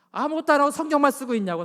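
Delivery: imitated exasperated wife, loud and high; retold nagging. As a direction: mimic a fed-up wife complaining at her husband. Mother nagging father, retold.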